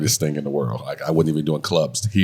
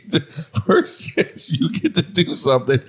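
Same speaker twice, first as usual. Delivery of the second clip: can't stop laughing; 12 semitones higher than usual. Real, not acted. Mock outrage at wife.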